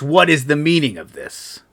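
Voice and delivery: calling out across a big hall; mild yell over the echo. Acted. Scion calling across the hall to the arriving party, a mild yell. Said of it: "he yells through the acoustics".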